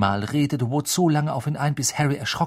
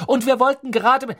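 bubbling over; 13 semitones higher, 5 dB louder, faster than usual. A narrator's reading. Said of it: "sprudelte Hermine los".

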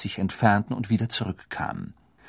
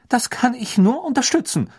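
much higher, sharp stern teacher; 12 semitones higher, 4 dB louder than usual. Acted. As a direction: cut in sharply and firmly. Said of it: "sagte Professor McGonagall scharf".

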